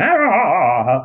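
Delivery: hammed-up take on a colleague's laugh; mock Australian laugh. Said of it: "He laughs in Australian".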